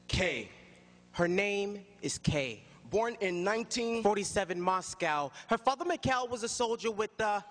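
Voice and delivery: measured, dramatic stage recital; spoken word poem. Acted.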